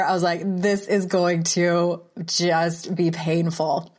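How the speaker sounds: normal talking voice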